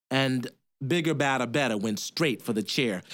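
ordinary delivery, nothing marked

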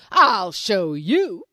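slow menacing lion; lion threatening reflection; slow threat. Acted. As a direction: drag out the threat.

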